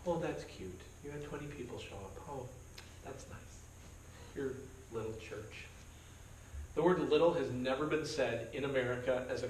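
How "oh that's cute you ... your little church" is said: condescending fake praise. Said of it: "you're like".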